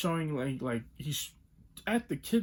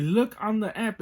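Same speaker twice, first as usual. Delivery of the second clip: retold exasperated order; 7 semitones higher, 6 dB louder than usual. Retold argument with clerk.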